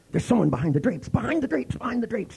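rising high, urgent repeats; hammed-up frantic pointing; mock alarm. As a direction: pretend panic to play along. Playing along with paranoia.

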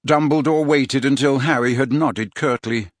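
normal talking voice

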